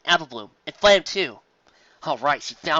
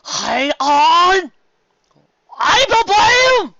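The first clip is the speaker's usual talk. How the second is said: much higher, loud drawn-out call; 11 dB louder, much slower than usual. Acted. Big Macintosh calls to his sister, loud and long. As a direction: call across the lawn.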